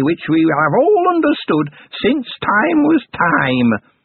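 put-on high, slow old goblin voice, solemn; solemn goblin crone. A narrator's reading. Goblin granny sealing the old bargain, slow high crone. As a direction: seal the deal like an ancient goblin.

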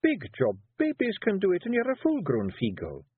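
high Scottish Feegle woman coaxing; wife coaxing her husband into writing. A narrator's reading. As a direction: push your husband gently, high and Scots. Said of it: "snapped Jeanie".

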